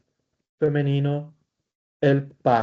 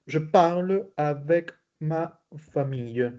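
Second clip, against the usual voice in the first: slow, word-by-word French for learners; slow model reading; 2 semitones higher than usual. Shown as a demonstration. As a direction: space out each word as a language teacher. Teacher models sentence slowly.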